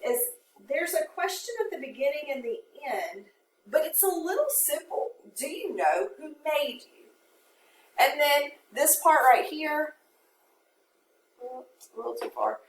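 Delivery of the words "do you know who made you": ordinary delivery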